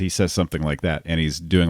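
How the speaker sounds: usual speaking voice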